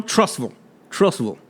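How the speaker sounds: soft Trussville, ending swallowed into "vul"; local pronunciation. Shown as a demonstration. Host answers his own "where are you from", Trussville as "Truss-vul". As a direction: answer softly, Trussville with "ville" like "vole". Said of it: "Like the ville is a vole".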